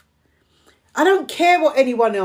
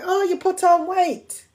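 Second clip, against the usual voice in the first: mock relative; 7 semitones higher than usual. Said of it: "what's the first thing a lot of family members say".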